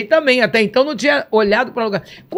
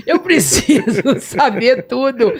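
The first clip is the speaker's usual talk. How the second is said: slow, laughing through Eu preciso saber tudo; laughing plea; 3 semitones higher, 4 dB louder, slower than usual. Retold or imitated. Begging Jesus for answers, laughing through it. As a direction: let the laugh break into the words.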